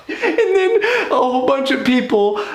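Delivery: high words squeezed through laughter; real leftover giggles lifting the pitch; laughing while talking. Real, not acted. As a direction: try to carry on while cracking up. Still laughing from the joke.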